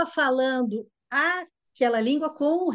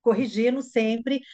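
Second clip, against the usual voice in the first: ordinary talk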